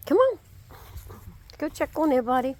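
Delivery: high, loud call to the dog; bright loud dog call. Real, not acted. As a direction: sing out a call to get the dog moving. Calling the dog Kane.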